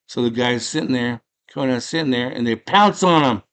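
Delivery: ordinary delivery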